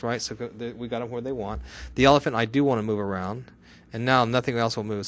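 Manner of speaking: ordinary talk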